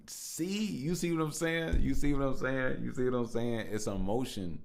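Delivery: high, loud and hyped, hammering the question; fired up, shouting at his listeners; excited shout. Real, not acted. Podcaster shouting to listeners, excited and loud.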